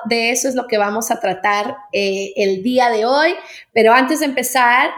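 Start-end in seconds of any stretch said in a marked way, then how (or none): none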